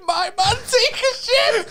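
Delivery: high, slow, shouted through laughter; laughing quote. Retold or imitated. Friend replays the stranger's words, laughing. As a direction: repeat the stranger's line, cracking up. Quoting the line while laughing.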